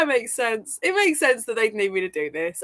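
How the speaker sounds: ordinary talk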